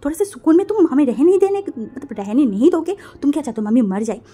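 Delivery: loud scolding that starts high; angry shout. A narrator's reading. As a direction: lash out loudly, high then dropping. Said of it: "गुस्से में देखा and चिल्ला के बोला".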